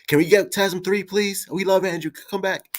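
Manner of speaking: high, begging fan voice; pleading fans. Retold or imitated. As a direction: beg like an eager fan. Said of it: "everybody's on Twitter like".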